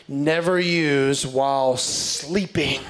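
slow deadpan reading. Real, not acted. Comic reading a hairdryer warning to the crowd, slow deadpan. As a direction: read each word slowly, deadpan. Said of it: "verify that it actually says this".

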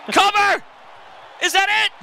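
excited play-by-play; excited pin call. Real, not acted. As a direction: call the cover urgently. Calling for the pin.

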